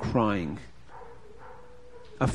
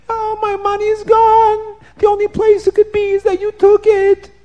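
crying woman; 6 dB louder than usual. A narrator's reading. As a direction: lament it, half-sung through tears. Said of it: "And the woman starts to cry".

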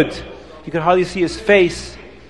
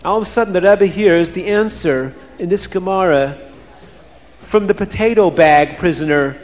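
usual speaking voice

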